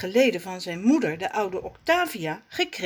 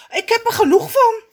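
high, loud, fast wail; outburst; 10 dB louder, much faster than usual. Acted. Raffie bursts out to Rommes, high and fast. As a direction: burst out, fed up. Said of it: "barst Raffie los".

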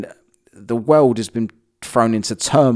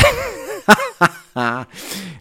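giggling laugh; 10 semitones higher than usual. Real, not acted. Laughing fit.

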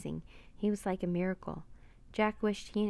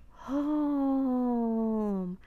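high, drawn-out breathy mournful call; unbearably sad; 5 semitones higher, 4 dB louder than usual. A narrator's reading. Octopus longs for the ocean's heart, unbearably sad. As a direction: let the word moan out slowly. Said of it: "The sound was unbearably sad".

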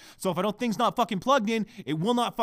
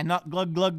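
usual speaking voice

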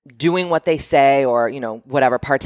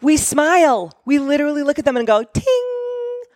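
much higher, sugary fake-smile voice; 12 semitones higher than usual. Showing how women fake a smile, squeaky high. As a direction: squeak out a forced sweet smile. Mimicking forced smile sound.

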